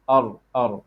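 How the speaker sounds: 'Ar' tapped, not from the throat; tongue-tip R. Shown as a demonstration. Teacher modelling the right sound, tongue-tip R. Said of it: "It is not from the throat".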